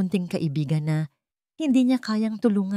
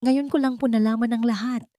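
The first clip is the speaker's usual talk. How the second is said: higher-pitched, desperate explanation; 6 semitones higher than usual. A narrator's reading. Lyra explains herself to Ricardo, desperate. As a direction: plead desperately to be believed. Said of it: "desperadong sabi ni Lyra".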